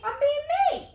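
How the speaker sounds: very high, loud squeaky protest; mock protest. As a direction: squeal it in self-defence. Protests against the lecture.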